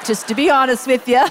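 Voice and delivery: laughing aside to the congregation. Real, not acted. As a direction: laugh out the confession. Laughing at own slap remark.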